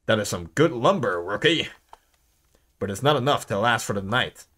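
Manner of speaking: low-pitched game-character voice; low, measured verdict to the rookie; gruff old watchman. Acted.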